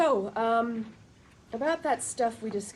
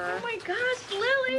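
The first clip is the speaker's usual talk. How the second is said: high, thrilled squeal of joy; excited squeal; 3 dB louder than usual. Acted. Friend thrilled by pregnancy news, high excited squeal. Robin hears Lily is pregnant.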